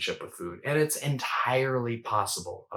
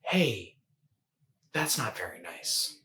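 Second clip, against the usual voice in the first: gentle mother's voice; slower than usual. Acted. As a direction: ease in kindly, with pauses. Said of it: "the mother's voice".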